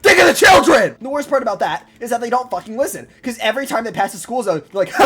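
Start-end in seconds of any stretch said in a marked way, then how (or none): 0.0-0.9 high, full-volume yelling; outraged officer shout. Acted. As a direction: bellow at a reckless driver in outrage. Officer scolding a speeding driver.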